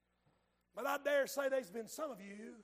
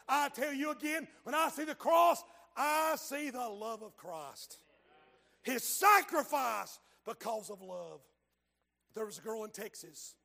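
ordinary talk

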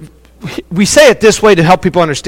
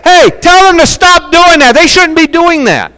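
indignant objectors; 9 semitones higher than usual. Acted. Preacher voices those against the praising, high-pitched indignant protest. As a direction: call out like a scandalized critic. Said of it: "There are those who are saying".

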